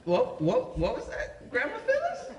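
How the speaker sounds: disbelieving, rising high on Phyllis; shocked. Retold or imitated. Grandson hears grandma ask for a joint, shocked and high-pitched. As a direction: stammer it out in shock.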